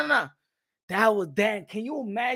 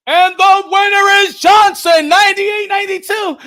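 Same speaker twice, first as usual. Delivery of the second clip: hammed-up announcer reading the winner; mock ring announcer; 5 semitones higher, 11 dB louder than usual. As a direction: boom the verdict like a ring announcer. Imitating ring announcer's verdict.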